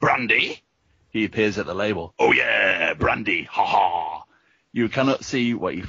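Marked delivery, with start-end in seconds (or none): 0.0-0.6 gruff alien. A narrator's reading. Nebulon questions the delivery. 2.2-4.2 drawn-out knowing delivery with mock laugh; slow down into a hammy ha ha; gruff doorman suddenly amused; gloating alien. A narrator's reading. Nebulon laughs at brandy delivery.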